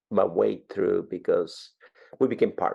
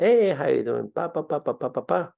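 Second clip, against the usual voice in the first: higher-pitched, playful door-to-door patter; self-mocking sales pitch; 5 semitones higher than usual. Salesman greeting a stranger at the door, self-mocking. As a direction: poke fun at your own accent with a rattled-off spiel. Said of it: "make fun of my accent".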